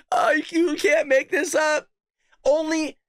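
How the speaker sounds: high, slow words squeezed through laughter; real incredulous laughing; laughing disbelief. Real, not acted. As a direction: struggle to talk while cracking up. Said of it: "I am laughing".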